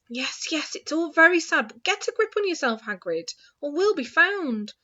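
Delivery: plain reading voice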